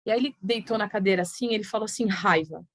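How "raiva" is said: put-on a low, sullen raiva from a slouched boy; imitating the boy's sullen answer; grim one-word answer. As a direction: mutter it low like a sulking kid. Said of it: "Desse jeito, assim".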